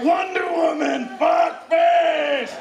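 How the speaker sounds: high, strained, loud cry; hammed-up high shout; mock demanding yell. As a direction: bellow it like a command in ecstasy. Acting shouted demand in sex scene.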